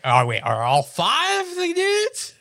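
high, slow, baffled mock question; confused surprise. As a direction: stretch it out, utterly confused. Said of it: "I was like".

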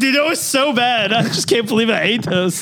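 high mock-disgusted friend; hammed-up regret over bad biscuits; imitating the friend. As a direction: squeak it out like it tasted awful. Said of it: "He's like".

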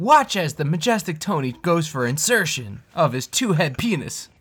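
grand wildlife narration voice; lightly voiced nature documentary; nature documentary narrator. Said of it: "Steve Irwin just narrating".

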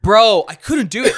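loud, high burst of disbelief; incredulous exclamation. Real, not acted. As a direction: blurt it out to your mate. Exclaims about failing to get up.

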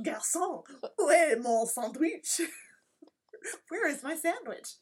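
high, loud mock outrage; mock demanding shout. Rude diner summoning French waiter for a sandwich, mock demanding shout.

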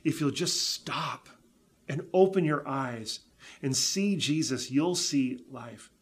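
normal talking voice

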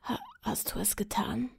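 faltering dying whisper; breaking voice. Acted. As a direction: let the voice break on the question. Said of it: "fragte der König mit brechender Stimme".

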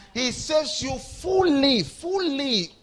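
high, loud, slow preaching shout; preacher shouting to the congregation; shouted proclamation. Real, not acted. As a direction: proclaim it to the whole church. Preacher proclaiming at full force.

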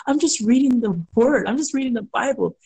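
retold panicked self-defense; scared. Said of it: "I was so scared".

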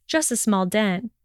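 ordinary delivery, nothing marked

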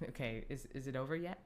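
low, soft, bored aside. Retold or imitated. Bored in the cinema, wanting the fight over, low and soft. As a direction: mutter it, fed up. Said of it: "I was just like".

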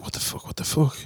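whispered in shock; alarmed whisper. Retold or imitated. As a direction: hiss it under your breath, startled. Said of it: "I'm like".

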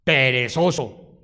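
a hint of a scolding father; scolding parent. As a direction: drag it out like a disappointed father. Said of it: "un padre que le diga a un hijo".

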